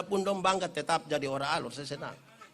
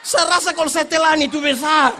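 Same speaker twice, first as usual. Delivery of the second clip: loud punchline; 9 semitones higher, 12 dB louder than usual. Real, not acted.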